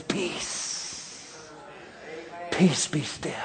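soft whispered calm; the pilot whispering peace to anxious hearts; soothing whisper. Acted. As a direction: breathe the words to still a storm.

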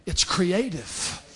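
quiet, slow restatement; soft aside. Real, not acted. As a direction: let it sink in softly, slowly. Soft repeat after rhetorical question.

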